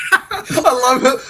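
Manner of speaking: laughing while saying it, slow; delighted laugh through the words. Real, not acted. Laughing at the fired up Scot, delighted. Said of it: "I love when he gets fired up".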